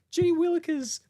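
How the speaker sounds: very high fussy Muppet voice; high-pitched mock of a friend; doing co-host's voice. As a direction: squeal it like a flustered puppet. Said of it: "Oh, you're doing me".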